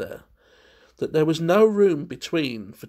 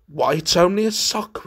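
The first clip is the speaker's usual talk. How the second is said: ordinary delivery, nothing marked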